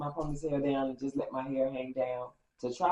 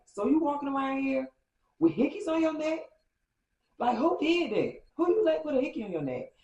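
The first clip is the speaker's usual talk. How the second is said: angry confrontation; 9 semitones higher, 5 dB louder than usual. Retold or imitated. Jealous ex confronts her.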